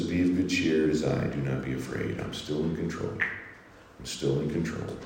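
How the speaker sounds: Jesus reassuring the faithful, softly; quiet reassurance. Acted. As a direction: hush their fear, slowing at the end. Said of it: "Jesus says".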